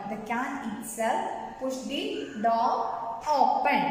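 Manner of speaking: slow, word-group by word-group; slow repetition. Shown as a demonstration. Teacher repeating a grammar example to pupils, slow dictation pace. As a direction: dictate it slowly with small breaks.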